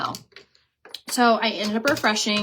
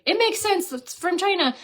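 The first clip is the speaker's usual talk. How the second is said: much higher, dismissive mock of know-it-all commenters; mocking viewers; 9 semitones higher than usual. Influencer imitating viewers blaming China, high mocking voice. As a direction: toss off their assumption in a silly high voice.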